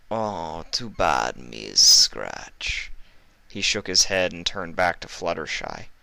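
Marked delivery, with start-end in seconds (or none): 0.1-2.9 put-on low, whispered northern-accented thug; menacing northern heavy. Iceberg promising cruelty to the captives, low whispered menace. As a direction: purr it, low and sinister.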